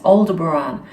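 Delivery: Aldebaran as "ol-de-buh-RAHN"; correct pronunciation of Aldebaran. Shown as a demonstration. Correcting others with the star people's version, Aldebaran ending in "-rahn". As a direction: open "ahn" closing the name Aldebaran. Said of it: "heard it from the star people themselves".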